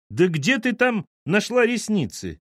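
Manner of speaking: high, stretched-out disbelief; incredulous. A narrator's reading. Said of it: "удивилась её мать". (удивлённо, высоким голосом)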